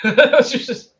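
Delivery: laughing through the words; giggling as you talk. Real, not acted. Laughing at own joke.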